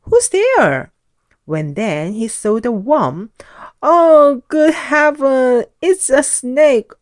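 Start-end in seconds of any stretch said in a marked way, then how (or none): 0.0-0.9 high, loud frightened call; startled shout. A narrator's reading. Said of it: "he shouted". 3.8-6.9 frantic panic. A narrator's reading. Jolly fears a snake.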